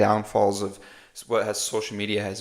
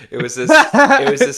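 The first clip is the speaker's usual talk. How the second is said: laughing; 12 semitones higher, 9 dB louder than usual. Real, not acted. Laughing while starting funny story.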